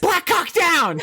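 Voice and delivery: high, laughing mock shout; shouted film quote. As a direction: holler it like a goofy movie line.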